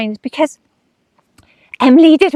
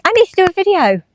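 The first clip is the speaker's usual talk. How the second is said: raised, high-pitched inner voice; self-quote under pressure; 10 semitones higher, faster than usual. Retold or imitated. Telling herself she needs a video, high self-quote. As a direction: say it higher, like a pressed reminder to self. Acting out her own pressure.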